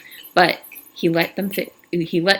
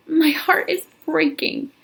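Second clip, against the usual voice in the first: slow and much higher, mildly tearful; heartbroken; 10 semitones higher, slower than usual. Acted. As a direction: plead it slowly, voice lifted. Catherine heartbroken confession.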